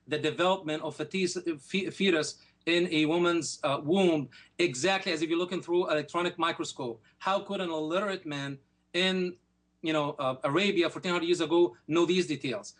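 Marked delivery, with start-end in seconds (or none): none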